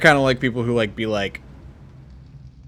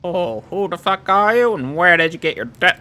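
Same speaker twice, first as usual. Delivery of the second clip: higher, louder put-on voice; trial character voice; 7 semitones higher, 5 dB louder than usual. Auditioning a voice for her, high and loud. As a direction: pitch it up and push it out, in character. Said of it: "don't wanna do that one".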